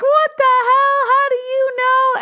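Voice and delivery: very high, loud squeal of disbelief; shocked shriek. Retold or imitated. Stunned cousin answering on FaceTime, high screeching disbelief.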